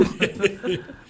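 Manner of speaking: short high laugh; quick amused laugh. Real, not acted. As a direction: chuckle high after your own punchline. Laughs at own punchline.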